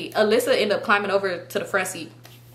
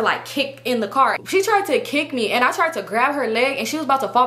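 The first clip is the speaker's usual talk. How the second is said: normal talking voice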